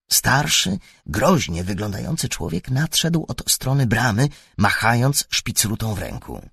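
ordinary delivery, nothing marked

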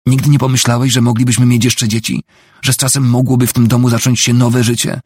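heated appeal to her husband; impassioned plea. A narrator's reading. Wife pleads in a quarrel.

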